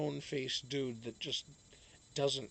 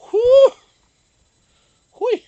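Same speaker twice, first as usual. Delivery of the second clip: very high, loud whoops; excited whoop; 24 dB louder than usual. Real, not acted. Streamer hyping the weather presenter, loud whooping. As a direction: cheer wildly. Cheering about the weather lady.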